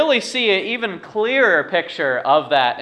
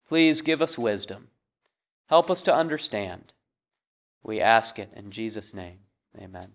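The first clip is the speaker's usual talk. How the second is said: prayer; 2 semitones lower than usual. Acted. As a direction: pray humbly for help, trailing off at the end. Pastor leads opening prayer.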